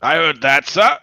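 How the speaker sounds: shouted, high and loud; loud call back. Acted. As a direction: call it out loud across camp. Legionary shouts back at commander.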